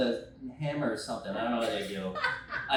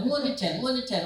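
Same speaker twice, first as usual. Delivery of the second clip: repeated prompt; 8 semitones higher, 3 dB louder than usual. Retold or imitated. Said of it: "yelling in my ear".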